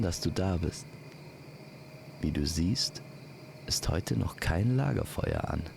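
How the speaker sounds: usual speaking voice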